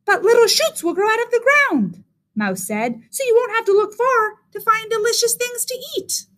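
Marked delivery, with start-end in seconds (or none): 0.1-2.0 very high little mouse squeak; teeny high-pitched mouse voice; tiny mouse. A narrator's reading. Mouse encourages Rabbit, voiced high. 3.1-6.3 very high little mouse squeak; teeny high-pitched mouse voice; tiny mouse. A narrator's reading. Mouse encourages Rabbit, voiced high.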